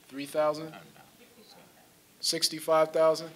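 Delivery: calling out figures like bids, with a questioning lift; lightly auctioneer-like, tossing numbers to the room. Real, not acted. Speaker fishing for guesses from the audience, a hint of auctioneer. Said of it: "I sound like an auctioneer".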